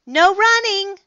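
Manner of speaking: high, loud scolding call; calling after. Acted. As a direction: call the rule after a running lion. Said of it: "Miss Merriweather called after him".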